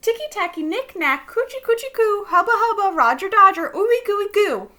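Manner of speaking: loud, high burst of words; blurted out. A narrator's reading. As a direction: blurt the silly words out at full volume. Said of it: "right up and blurted out".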